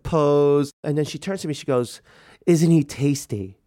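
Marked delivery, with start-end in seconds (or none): 2.5-3.6 slow, sly and suggestive; mock-seductive drawl. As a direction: savor the word tasty, teasing. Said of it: "the way she said it".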